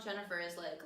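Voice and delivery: ordinary delivery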